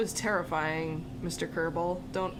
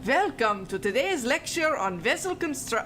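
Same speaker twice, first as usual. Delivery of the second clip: put-on hammy German accent, higher up; terrible German accent; 4 semitones higher than usual. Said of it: "do this in a terrible German accent".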